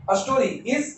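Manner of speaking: normal talking voice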